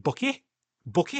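high, squeaky mock Welsh "Bookie? Bookie?"; mock Welsh accent. Mocking the Welsh character's odd word, mock Welsh accent. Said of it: "she said it like".

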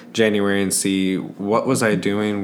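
ordinary talk

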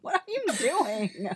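high, slow, breaking into laughter; laughing. Real, not acted. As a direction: squeak it out through giggles. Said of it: "she's laughing too hard".

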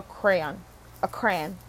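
"crayon" squashed into one syllable, like "cran"; regional pronunciation. Shown as a demonstration. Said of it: "I say crayon".

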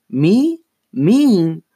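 high, loud mock-innocent questions; wide-eyed innocence. A narrator's reading. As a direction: act shocked at the accusation, pitched high. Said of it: "in a wide-eyed nu-, nuisance".

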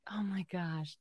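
soft, breathless whisper. Real, not acted. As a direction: whisper it in disbelief. Reacting in disbelief to the story.

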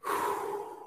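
long breathy blown-out sigh; soft mock sigh of relief; imitated heavy sigh. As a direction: let out a slow exhale, mimicking a dazed friend. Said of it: "and he goes".

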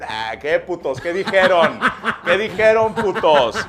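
loud, laughing teasing question. Real, not acted. Laughing loudly as he teases his friend. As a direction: blurt it out through laughter.

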